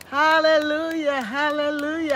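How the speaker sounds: ringing hallelujahs, full of praise; joyful praise. Real, not acted. Livestream host giving God glory to her viewers, joyful and ringing.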